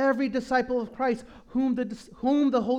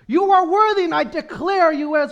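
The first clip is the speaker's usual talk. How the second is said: much louder and higher, building; shouted worship declaration; fervent proclamation; 5 semitones higher, 7 dB louder than usual. Real, not acted. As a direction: lift it up in praise. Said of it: "reads then preaches psalm".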